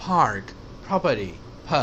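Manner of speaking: پارک، پراپرٹی with a puff of h after the P; airy English P in پراپرٹی; aspirated P. Shown as a demonstration. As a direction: breathe out on the پ of پارک. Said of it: "تھوڑا سا ایچ ہے اس میں".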